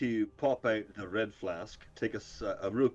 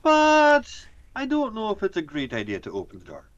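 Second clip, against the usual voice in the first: character voice; 9 semitones higher, 9 dB louder than usual. Acted. Character voice, loud and high.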